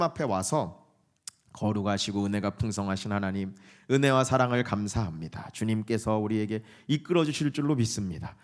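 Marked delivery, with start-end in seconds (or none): 1.5-8.4 low, even monotone prayer; mock pious drone that hides all feeling; formulaic prayer. Shown as a demonstration. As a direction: recite the prayer by rote on one note. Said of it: "슬픈데 이렇게 기도한다니까요".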